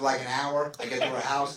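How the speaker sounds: ordinary delivery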